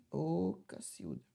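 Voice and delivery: ordinary talk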